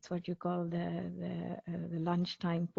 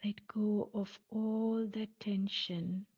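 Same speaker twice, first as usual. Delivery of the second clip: guided meditation. Acted. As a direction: guide listeners calmly, phrase by phrase, leaving space between. Leads a guided mindfulness body scan.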